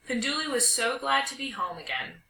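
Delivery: plain reading voice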